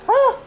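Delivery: sudden high shriek of fright; terrified scream. Retold or imitated. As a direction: shriek like you got jump scared. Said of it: "one jump scare that really made me scream".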